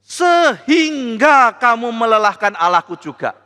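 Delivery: slow, loud shouted rebuke; prophetic rebuke. Acted. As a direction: thunder the rebuke at the king.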